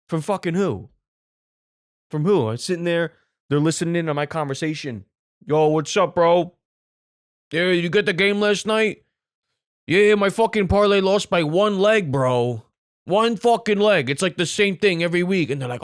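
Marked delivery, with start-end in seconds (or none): 5.5-15.5 loud, higher-pitched bro banter; hammed-up aggrieved betting bro; sports bro. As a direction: complain loudly like a bro about a lost bet. Acts out bro talk about betting.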